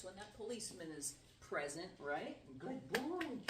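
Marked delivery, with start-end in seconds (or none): 2.6-3.4 high, bright dog praise. Real, not acted. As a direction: praise the dog in a sing-song.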